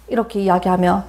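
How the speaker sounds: normal talking voice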